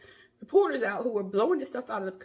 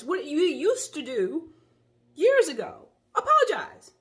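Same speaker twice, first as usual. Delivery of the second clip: climbing higher and louder to a near shout; exasperated outburst; 6 semitones higher, 8 dB louder, slower than usual. Real, not acted. Vlogger lamenting that nobody apologizes anymore: rising exasperated cry. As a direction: build to a cry of disbelief.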